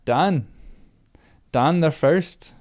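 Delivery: put-on playful try at a Scottish accent; slow, hammed-up Scottish lilt. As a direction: put on a little Scottish brogue. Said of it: "could be a little Scottish".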